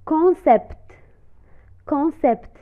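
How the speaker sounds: ordinary delivery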